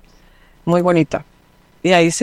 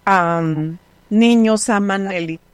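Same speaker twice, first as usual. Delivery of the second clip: American lady's broken Spanish; slower than usual. Retold or imitated. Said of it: "en su español me dice".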